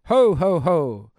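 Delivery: loud jolly Santa laugh; booming ho ho ho. Acted. Ghost of Christmas present announcing himself with a laugh. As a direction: belly laugh like Father Christmas.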